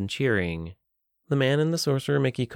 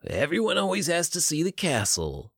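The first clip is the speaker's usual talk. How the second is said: high, put-on costumed showman; a creepy mascot performer; gruff man's voice; 7 semitones higher than usual. A narrator's reading. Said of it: "in a gruff man's voice".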